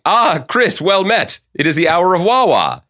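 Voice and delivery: high, hammy olde English greeting; renaissance fair olde speech. Pretend. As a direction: play a mock medieval herald. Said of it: "we'd be like".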